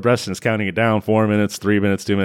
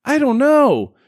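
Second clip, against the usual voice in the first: high helpless whine; 12 semitones higher, 4 dB louder than usual. Retold or imitated. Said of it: "he says".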